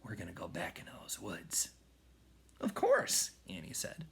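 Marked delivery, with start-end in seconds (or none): 0.0-1.7 breathy whispered word; soft whisper. A narrator's reading. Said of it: "Jack said softly". 2.6-3.3 eager high reply. A narrator's reading. Annie answering eagerly.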